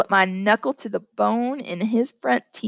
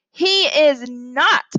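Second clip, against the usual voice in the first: slow, louder, raised-pitch denial; indignant roar; 4 semitones higher, 5 dB louder, much slower than usual. Acted. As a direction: roar it, stretching every word. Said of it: "I roared".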